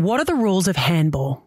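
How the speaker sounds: usual speaking voice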